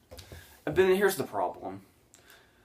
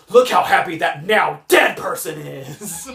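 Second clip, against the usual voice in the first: loud, slow sarcastic proclamation; hammed-up loud declaration; mock exultant announcement; 3 semitones higher, 6 dB louder than usual. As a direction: belt it out with mock glee. Mocking film's sappy montage message.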